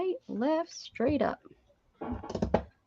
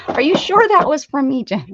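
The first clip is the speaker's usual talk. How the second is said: playful loud; 3 semitones higher, 7 dB louder than usual. Acted. Playful repeated question, louder.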